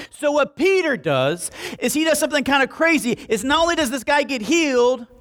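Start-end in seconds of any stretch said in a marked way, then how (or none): none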